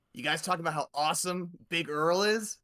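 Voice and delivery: eager gator showing off a gift; Jerome. Acted. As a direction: gush loudly about your hero. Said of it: "And Jerome's gonna say".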